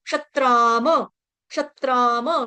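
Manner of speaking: क्षत्राम slow, long aa, vowel after the m; full final ma. Shown as a demonstration. Teacher modelling the correct word slowly, क्षत्राम ending in ma. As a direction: stretch क्षत्राम and finish on a whole म. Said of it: "म पूरा है".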